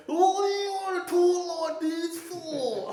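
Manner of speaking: high, slow mocking slur; mock slurred talk. Mocking the way homeless guys talk, high and slow. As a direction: drag the words out, mimicking them.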